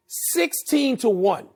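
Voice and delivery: "Sixteen to one" said slowly, each word weighted; slow emphatic repeat. Real, not acted. Analyst repeating the stat to Tony, slow and emphatic. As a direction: spell it out slowly for the doubters. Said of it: "Let me say it again".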